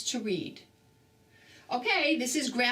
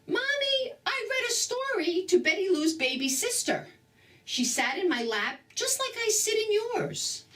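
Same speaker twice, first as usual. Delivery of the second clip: put-on much higher, eager little-boy voice; excited child; 11 semitones higher than usual. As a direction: burst in with happy news. Grover rushes home with news.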